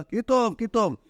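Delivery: calling out. Acted. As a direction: call out for someone at the synagogue gate. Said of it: "צבח אל תרא דקנישתא".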